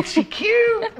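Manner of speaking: high squeaky gush; high delighted squeal. Real, not acted. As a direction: coo like over a puppy. Gushing over guest.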